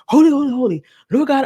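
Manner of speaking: high, loud parody of prayer; mock praying. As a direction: mimic overfervent praying. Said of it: "that prayer just seemed a little bit weird".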